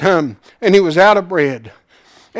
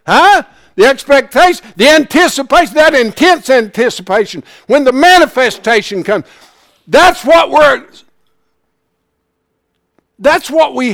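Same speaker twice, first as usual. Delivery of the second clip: rising, louder preaching build; preaching at full force; 8 semitones higher, 6 dB louder than usual. Real, not acted. Preacher stirring the congregation, loud and rising.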